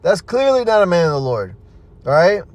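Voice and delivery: ordinary delivery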